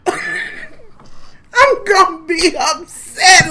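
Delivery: slow, high laugh-cry. Real, not acted. Imagining the scale's verdict, laughing mock sob.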